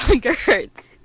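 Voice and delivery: drawn-out dramatic moan; melodramatic. Acted. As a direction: ham up the suffering. Mock dramatic repeat of catchphrase.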